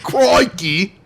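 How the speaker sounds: put-on Aussie exclamation, vowel stretched, rising; Australian accent. Shown as a demonstration. Repeating the approved attempt, mock Australian accent. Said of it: "let me hear your Australian accent".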